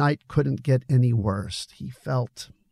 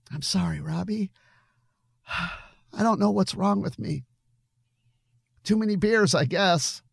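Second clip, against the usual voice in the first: tearful apology; 9 semitones higher than usual. A narrator's reading. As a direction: sigh and apologise, still tearful. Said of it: "his face streaked with tears".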